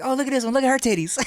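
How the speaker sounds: high, light, giggly Michael impression; Michael checking out a fan. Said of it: "he'd be like".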